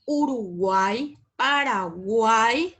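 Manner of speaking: slow "Uruguay, Paraguay", stretching the final guay; drawn-out triphthong. Shown as a demonstration. Teacher giving triphthong examples, final guay drawn out slowly. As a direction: hold the last syllable of "Uruguay" and "Paraguay" as one glide.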